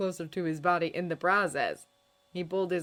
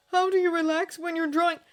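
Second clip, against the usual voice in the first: put-on louder, higher young man under effort; strained Hiccup; 12 semitones higher, 8 dB louder than usual. A narrator's reading. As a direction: ask through gritted teeth. Hiccup asks while straining.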